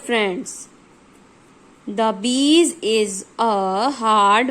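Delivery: slow, word-by-word exercise reading; teacher dictating sentences at a very slow pace; dictation reading. Acted. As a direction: read each sentence out slowly for the class.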